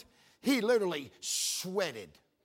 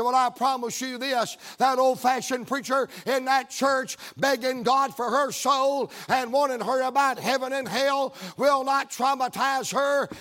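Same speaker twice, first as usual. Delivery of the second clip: full-voiced, raised preaching rebuke; loud, defiant sermon delivery; even bolder; 3 semitones higher, 3 dB louder than usual. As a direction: drive it home like a revival preacher. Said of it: "then I got even more bold".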